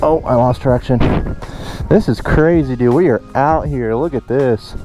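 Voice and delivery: ordinary talk, nothing marked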